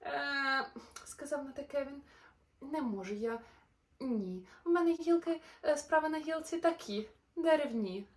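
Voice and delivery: lively, sing-song storybook narration; animated bedtime-story voice; reading to children. A narrator's reading. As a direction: read the rhymes brightly to little listeners. Reads a rhyming children's story.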